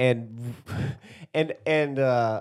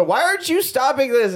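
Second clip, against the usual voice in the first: mock yell; 10 semitones higher, 4 dB louder than usual. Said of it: "Armand's yelling through the door".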